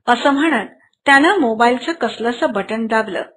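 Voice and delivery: usual speaking voice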